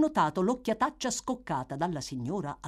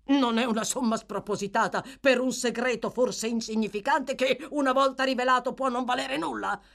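higher, louder, exasperated protest; impatient; 8 semitones higher, 5 dB louder than usual. A narrator's reading. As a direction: snap back, losing patience. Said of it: "esclamò Monks spazientito".

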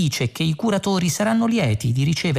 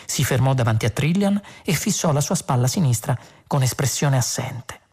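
ordinary delivery

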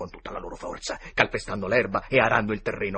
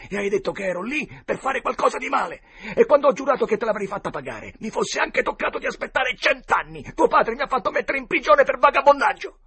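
higher and louder, building to a raging shout; furious threat; 11 semitones higher, 8 dB louder, faster than usual. A narrator's reading. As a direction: spit out years of hatred at him. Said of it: "minacciava il dottore agitandogli il pugno". (Joe l'Indiano minaccia il dottore, con rabbia crescente)

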